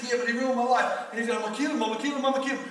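angry threat. Retold or imitated. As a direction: spit out the threat over and over.

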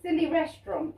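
normal talking voice

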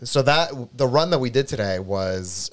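ordinary talk, nothing marked

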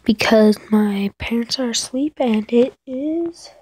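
whispering so parents sleep; hushed whisper; quiet at night. Real, not acted. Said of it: "I need to be a little bit quiet".

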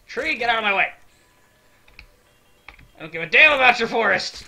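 loud, high-pitched yell; mock outrage. Gamer yelling at a tree in the way, mock outrage. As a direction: bellow in play anger at an obstacle.

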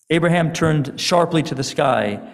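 usual speaking voice

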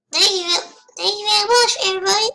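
high, loud mock British accent; hammed-up, botched British thanks. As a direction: put on a big, bad British accent. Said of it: "I forgot the British accent".

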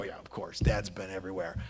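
low, very soft dismissive aside; imitated unimpressed kids. Kids commenting on dad's travels, quietly unimpressed. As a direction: toss it off low, mimicking blasé kids. Kids' weary reply about well-travelled dad.

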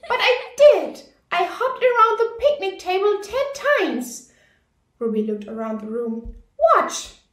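put-on high, loud, insistent little girl; little girl Ruby. A narrator's reading. Ruby insists to the giggling class, high eager girl voice. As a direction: protest like a small girl who must be believed.

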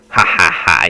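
loud, spaced-out triumphant laugh; big gloating ha-ha-ha. Real, not acted. Gloats after leaving zombies behind.

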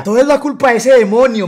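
loud, high mock maternal outrage; accusing mother. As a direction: imitate an indignant mom pointing blame. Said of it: "Pero la mamá ... decía". (imitando a una madre indignada)

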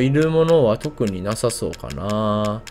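usual speaking voice

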